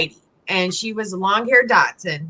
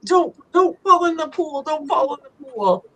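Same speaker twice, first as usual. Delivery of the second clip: higher, anxious pleading to the dog; pleading worry; 8 semitones higher than usual. Retold or imitated. Owner retelling how she begged the blind dog, in mild pleading worry. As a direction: beg the blind dog to stay clear. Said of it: "And I'm like".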